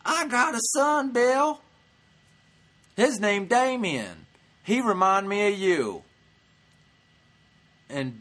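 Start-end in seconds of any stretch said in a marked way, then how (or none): 0.0-6.0 loud, high-pitched mock heckling; imitated heckler shouting. Heckler telling Bill about a son, shouted imitation. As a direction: holler it out like an audience heckler.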